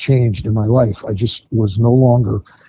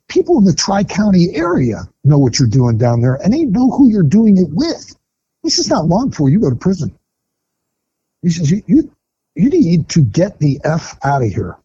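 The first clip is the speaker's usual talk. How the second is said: retold furious warning; 7 semitones higher than usual. Sponsor telling him to get out, high angry warning. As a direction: mimic the sponsor ordering him out.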